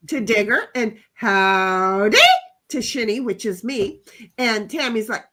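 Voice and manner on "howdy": cowboy howdy. Acted. Reading a chat greeting in character.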